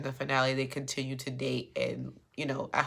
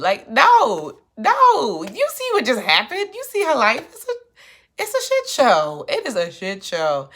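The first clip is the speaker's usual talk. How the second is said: much higher and louder, incredulous; exasperated outburst; 8 semitones higher, 11 dB louder than usual. Real, not acted. Recapper answering Molly's question in loud disbelief. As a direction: throw up your hands in disbelief.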